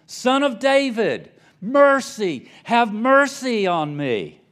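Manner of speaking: very high, loud desperate yell, slow at the end. A narrator's reading. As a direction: shout over the crowd trying to hush him. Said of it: "he yelled all the louder".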